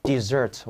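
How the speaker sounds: DESSERT as dih-ZERT, stress moved back; back-stressed "dessert", weak first syllable; stress on the second syllable. Shown as a demonstration. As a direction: say "dessert" as dih-ZERT, like the verb meaning abandon. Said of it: "做动词重音往后挪".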